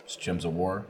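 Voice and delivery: ashamed mumble. Retold or imitated. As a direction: admit it sheepishly under your breath. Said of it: "He's ashamed of it".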